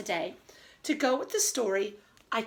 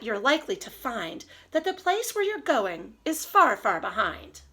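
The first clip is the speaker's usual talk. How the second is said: lively storybook read-aloud, slowing on the rhymes; reading to children; 2 semitones higher than usual. Acted. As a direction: savor each silly rhyme for the kids.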